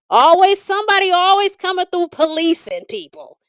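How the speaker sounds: loud, high burst of frustration; loud fed-up exclamation; exasperated outburst. Real, not acted. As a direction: blurt it out fed up. Exasperated outburst about policing.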